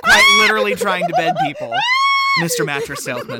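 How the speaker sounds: laughing, high and loud mockery; laughing shout. Real, not acted. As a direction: yell it while cracking up. Mocking Ted, laughing shout.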